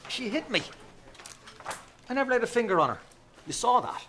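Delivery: high, loud protesting; indignant protest. Acted. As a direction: insist she attacked you. Protesting innocence, agitated.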